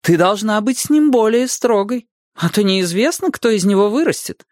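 high-pitched schoolmarm lecturing; old-fashioned governess. A narrator's reading. Boy lecturing the girl on strictness, in a put-on governess voice. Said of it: "голосом старинной классной дамы заявил Лён".

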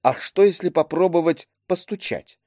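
mildly uncertain proposal; unsure suggestion. A narrator's reading. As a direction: float the idea hesitantly. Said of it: "он не очень уверенно предложил".

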